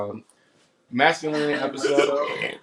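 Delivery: normal talking voice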